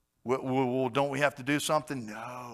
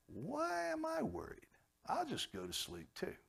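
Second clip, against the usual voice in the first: mock wonder; 7 dB quieter than usual. Imagined believer musing.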